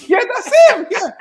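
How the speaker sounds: bursting into loud, high laughter; cracking up at the impression; laughing shout. Real, not acted. Host reacts to the Levy voice, laughing loudly.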